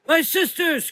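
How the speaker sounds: high, loud cry of despair; anguished cry. A narrator's reading. Mother Azra watching her sisters burn, anguished cry. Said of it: "cried out Mother Azra".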